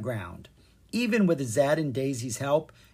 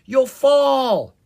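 high, loud warning shout; crying out; 11 semitones higher, 11 dB louder than usual. A narrator's reading. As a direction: yell it in alarm, stretching the last word. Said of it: "Kara cried".

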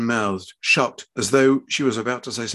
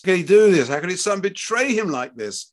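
high, pained indignation; betrayed outrage; 7 semitones higher, 4 dB louder than usual. A narrator's reading. Father inwardly reeling at his child, betrayed outrage. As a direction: start loud and high, then ease off.

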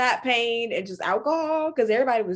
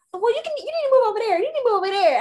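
imitating Wendy; 10 semitones higher, much faster than usual. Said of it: "she was like".

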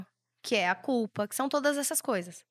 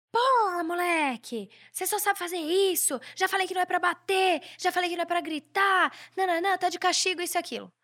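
hammed-up angry parent yelling; imitating a yelling father; 7 semitones higher than usual. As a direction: play the furious dad shouting at his son. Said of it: "o pai começa a gritar".

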